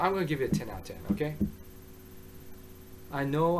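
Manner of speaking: normal talking voice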